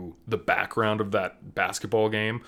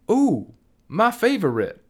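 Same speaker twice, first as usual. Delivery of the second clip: high, louder hammed-up delight; mock LeBron delight; 10 semitones higher, 6 dB louder than usual. Imitating LeBron's cheesy reaction shot, high and hammed-up. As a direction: overplay the joy like a bad actor.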